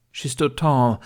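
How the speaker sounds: ordinary delivery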